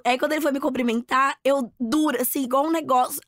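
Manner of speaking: normal talking voice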